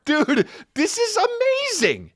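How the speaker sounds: high, slow, dopey delight; giddy Jake Paul impression. Podcaster imitating the thrilled fighter, high and slow. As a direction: stretch it out like a thrilled kid.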